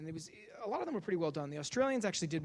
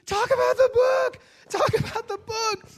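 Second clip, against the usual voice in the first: high, loud, slow desperate yell; screaming at the TV; 13 dB louder, slower than usual. Retold or imitated. Comedian yelling at Oprah on TV, desperate high scream. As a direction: scream it at the screen. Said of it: "I'm just screaming at the T V".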